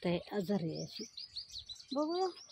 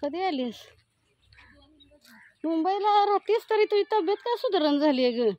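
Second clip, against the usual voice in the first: loud visit talk; 5 semitones higher, 6 dB louder than usual. Real, not acted. Woman visiting a sick grandmother, speaking loud. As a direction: speak up to a hard-of-hearing grandmother.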